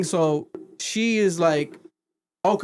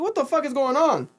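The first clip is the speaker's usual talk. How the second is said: baffled; 11 semitones higher than usual. Retold or imitated. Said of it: "And I'm like".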